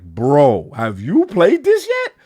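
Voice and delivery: loud, incredulous, pitch leaping up at the end; hyped friend. Retold or imitated. Friends asking if he has played it yet, hyped. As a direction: press the question with excited, high-rising disbelief. Friends urging him about the game.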